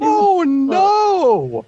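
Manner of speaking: high, loud cry of dismay; mock horror. Real, not acted. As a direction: shriek it like a cartoon fright.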